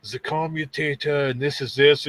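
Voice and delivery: mock Austrian explainer voice; German accent. Guy explaining tools in a German accent. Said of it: "the German or Austrian accent".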